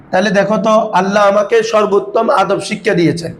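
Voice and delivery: usual speaking voice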